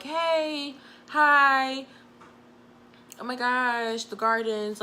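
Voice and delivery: imitating the careful tone you use with a boss; polite voice for the boss. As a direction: lightly put on a bright polite greeting for the boss. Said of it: "you change your tone of voice".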